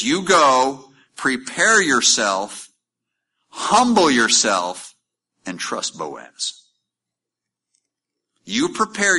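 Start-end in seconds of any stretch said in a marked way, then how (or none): 0.0-6.6 slow, emphatic, each phrase stressed; measured commands. Acted. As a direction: lay out each step slowly and firmly. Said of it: "She says".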